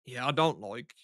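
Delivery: ordinary delivery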